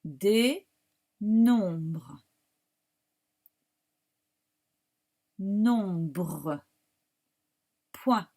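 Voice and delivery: teacher dictating, long pauses between words; isolated, carefully sounded words; dictation. Shown as a demonstration. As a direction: repeat each word slowly for writing. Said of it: "je vous la dicte après".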